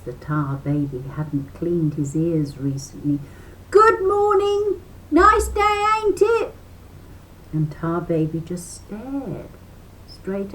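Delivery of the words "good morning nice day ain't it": high loud repeated greeting; loud high rabbit hollering hello; rabbit shouting. A narrator's reading. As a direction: shout the greeting again, insistently. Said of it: "shouted Brer Rabbit".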